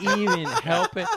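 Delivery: squeaky laugh through words; can't stop laughing; laughing high. Real, not acted. Telling the improv story, laughing high. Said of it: "I couldn't".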